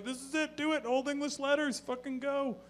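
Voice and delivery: high, eager commands; a dude demanding his tattoo now; frantic excited urging. Retold or imitated. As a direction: hurry them like it's urgent. Guy rushing to tattoo parlor.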